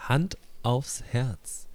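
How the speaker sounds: very slow, drawn out; slow motion. Shown as a demonstration. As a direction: stretch "Hand aufs Herz" slowly. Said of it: "ganz langsam sagen".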